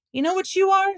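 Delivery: high, loud challenge; taunting challenge. A narrator's reading. Alan challenges Billy nose to nose, high loud taunt. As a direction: get in his face with the question. Boys squaring up for fight.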